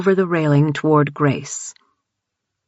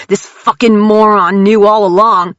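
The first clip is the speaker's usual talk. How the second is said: loud, higher, raging accusation; furious shout; 5 semitones higher, 8 dB louder than usual. A narrator's reading. Jared raging at Grant on the stairs, loud furious shout. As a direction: spit it out in fury. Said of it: "Jared shouted".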